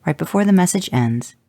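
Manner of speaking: ordinary delivery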